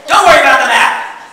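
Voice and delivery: loud, high and dismissive; stubborn driver. Shown as a demonstration. Presenter playing a husband who refuses directions, loud. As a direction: wave off the map impatiently. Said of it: "Any guys relate to this?".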